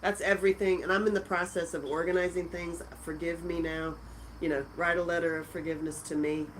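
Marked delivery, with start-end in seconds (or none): none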